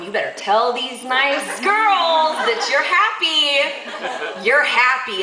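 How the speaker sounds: slow, drawn-out mock threat; insistent demand. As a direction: stretch the words out, imitating a pointed order. Acted insistence before the onlookers.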